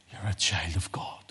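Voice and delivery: tender unvoiced whisper; whispered reassurance; soft whisper. Real, not acted. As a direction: breathe it gently to them. Intimate assurance.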